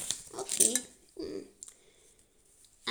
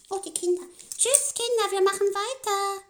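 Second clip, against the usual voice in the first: excited goodbye; 6 semitones higher, 8 dB louder than usual. Acted. Puppets say goodbye.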